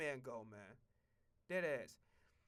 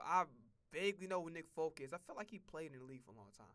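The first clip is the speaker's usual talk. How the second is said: ordinary talk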